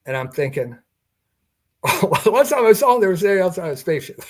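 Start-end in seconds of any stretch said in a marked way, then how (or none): 1.8-4.3 fast, louder, much higher words bubbling through a laugh. Retold or imitated. Telling the interviewer his private joke, higher and laughing. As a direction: blurt it out on a giggle.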